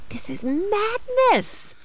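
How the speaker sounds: high, slow mock disbelief; dramatic disbelief. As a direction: stretch out a stunned cry. Retold own reaction.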